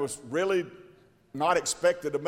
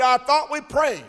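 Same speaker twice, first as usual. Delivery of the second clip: indignant retort; 9 semitones higher, 6 dB louder than usual. Retold or imitated. Man's retort in retold story.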